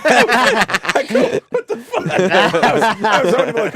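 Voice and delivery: laughing hard, high and loud; to the hosts, laughing at being snubbed by De Niro. Real, not acted. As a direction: crack up recalling the snub. De Niro walked away.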